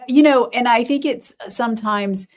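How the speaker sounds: ordinary delivery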